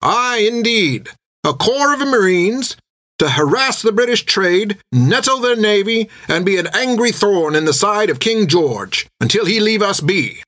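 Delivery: rally the room like a proud naval officer; a sea captain's ringing pitch to a crowd; recruiting sea captain. Acted. Captain continues his pitch.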